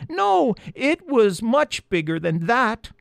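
high, slow squeaky insistence; interrupting mice. A narrator's reading. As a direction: squeak over him to correct. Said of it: "the mice interrupted loudly".

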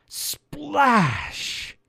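high, drawn-out splash word; climactic exclamation. A narrator's reading. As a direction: let the word burst out long and high. Climax of the fall.

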